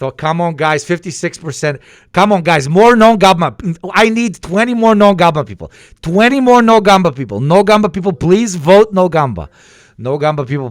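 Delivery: yelling at chat, high and loud, begging for votes; loud desperate pleading. Real, not acted. Streamer yells at chat to vote no, loud desperate begging. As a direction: plead loudly and urgently.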